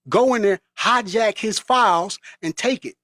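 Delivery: usual speaking voice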